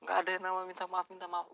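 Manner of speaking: fast, high, loud rebuke; fast angry scolding. Retold or imitated. Customer scolding the cashier for not apologizing, fast, high and loud. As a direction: rattle off the complaint in fury.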